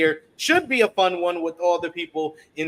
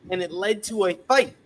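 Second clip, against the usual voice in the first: ordinary talk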